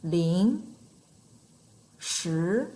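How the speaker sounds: "Ling, shi", each gliding upward; second tone, rising. Shown as a demonstration. Teacher having learners feel the second tone, rising pitch. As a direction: lift the pitch steadily through "Ling" and through "shi". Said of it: "That's our second tone".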